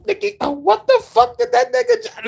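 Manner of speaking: squeaky mock rapper. Mocking the rapper in a squeaky voice. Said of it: "if he goes".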